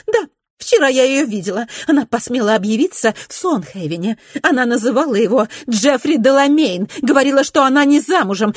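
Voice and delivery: high, excited gossiping lady; a high-pitched, eager young society lady. A narrator's reading. Narrator voices Mrs Glenarm.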